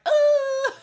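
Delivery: high, loud yelp of pain; replaying the fall with a sudden loud cry. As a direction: let out a sharp cry as if you just fell. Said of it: "And I'm like".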